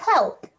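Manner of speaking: Help said alone and loud, crisp final p; sounding out Help. Shown as a demonstration. Teacher sounding out Help for children spelling it. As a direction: punch out Help clearly for spelling. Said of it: "C V C C words".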